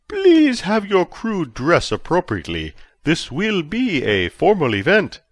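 high, slow, polite official voice; formal chancellor. A narrator's reading. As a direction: ask graciously, like a pompous host. Verbidi requests formal dress.

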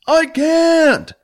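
loud high reply mid-run; shouting back. Acted. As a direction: yell back while running.

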